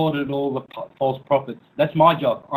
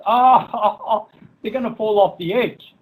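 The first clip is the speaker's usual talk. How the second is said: mocking laugh; 6 semitones higher than usual. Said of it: "like a parrot repeating stupid".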